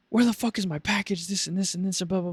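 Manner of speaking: high, ranting complaint; mock angry customer. Irate customer yelling at the agent, high mock rant. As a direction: rant like a furious caller.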